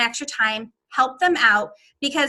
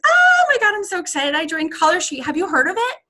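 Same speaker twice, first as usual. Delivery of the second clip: high, loud squeal settling into eager chatter; hammed-up burst of phone excitement; excited caller; 5 semitones higher, 4 dB louder than usual. Shown as a demonstration. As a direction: gush about big news, demonstrating excitement. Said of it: "they hear the excitement in your voice".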